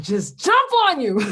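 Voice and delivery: loud, high playful outburst; mock exasperation. Joking about a partner trying her patience, loud mock exasperation. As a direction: burst out as if about to pounce.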